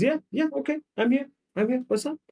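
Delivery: high-pitched, eager reply; eager reassurance. Acted. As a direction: jump in as an over-willing partner. Acted man answering partner eagerly.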